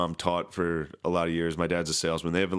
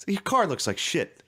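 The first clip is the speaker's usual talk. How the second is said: higher-pitched mock scolding; imitated angry dad; 11 semitones higher than usual. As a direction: play the furious dad telling off his son. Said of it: "He was so mad".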